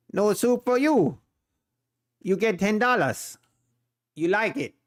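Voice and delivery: a lightly voiced Seinfeld soup seller, amused; mock Soup Nazi. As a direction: play the stern soup vendor turning someone away, half-joking.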